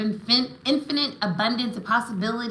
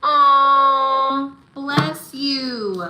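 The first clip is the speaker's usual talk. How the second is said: higher, drawn-out cooing sympathy; touched, tender; 5 semitones higher, 7 dB louder, much slower than usual. Real, not acted. Reader consoling a moved client, tender and cooing. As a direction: open on a long, loud aww and soften.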